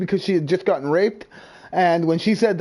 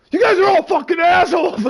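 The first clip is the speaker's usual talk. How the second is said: loud, high mock outburst; angry shout; 11 semitones higher, 5 dB louder than usual. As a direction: imitate a furious man cursing the whole room. Retold angry man cursing everyone.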